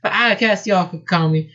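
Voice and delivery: high-pitched southern belle voice; thick southern accent. Acted. Rabbit answers the hedgehog, southern accent. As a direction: put on a high southern twang. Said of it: "Her voice was a thick southern accent".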